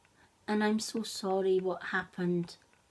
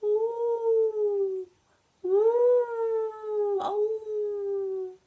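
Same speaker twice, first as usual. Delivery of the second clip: long high howling ooh; wolf howl; 13 semitones higher, 5 dB louder, much slower than usual. Acted. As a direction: throw your head back and howl like a wolf cub. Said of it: "we howl like this".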